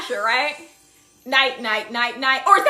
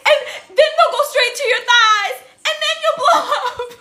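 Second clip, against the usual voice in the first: higher laughing imitation; cartoon quote; 9 semitones higher, 3 dB louder than usual. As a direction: mimic him through laughter, pitched up. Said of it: "He was like".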